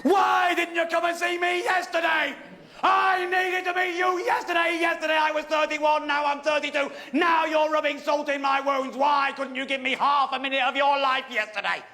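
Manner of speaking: furious, aggrieved tirade, easing in pitch; unconcealed anger. Acted. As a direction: let the hurt burst out as yelling. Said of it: "I could not conceal my anger".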